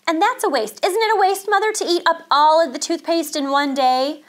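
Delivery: put-on child scandalised by a brother's waste; indignant tattling. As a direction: press mother to agree. Sister tattles, appeals to mother.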